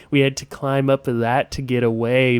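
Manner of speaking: ordinary talk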